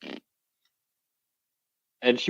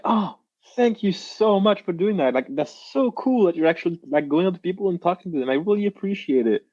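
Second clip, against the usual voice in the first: grateful woman; 6 semitones higher, faster than usual. Retold or imitated. Grateful woman quoted by approach story.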